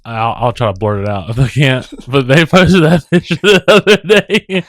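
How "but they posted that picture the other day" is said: slow, high, giggled telling; cracking up mid-sentence; laughing. Real, not acted. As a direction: push the words out through laughter. Cracking up while retelling.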